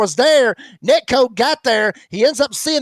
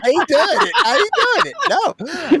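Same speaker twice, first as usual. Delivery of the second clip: mock silly voice; 10 semitones higher than usual. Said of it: "Do it one more time".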